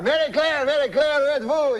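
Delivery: high, excited French-Canadian calling; Jean-Luc's French-Canadian accent. Acted. Uncle runs in calling his wife, high French-Canadian accent. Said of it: "That's how he talks".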